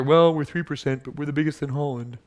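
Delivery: mock a self-satisfied excuse in a condescending voice. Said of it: "was that a condescending enough voice".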